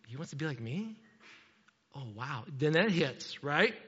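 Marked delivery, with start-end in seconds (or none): 0.1-2.4 very soft, low, awed voice; hushed realisation. Retold or imitated. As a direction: let it sink in quietly, almost to yourself. Father's dawning realisation, retold.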